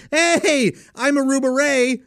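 high, loud, greasy greeting; Aruba Ray voice. Shown as a demonstration. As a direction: greet like a smarmy bar owner, way up high. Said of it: "and I just did it".

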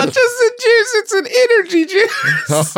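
high, squeaky laughing words. Real, not acted. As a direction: laugh through the line. Laughing at dad's boast.

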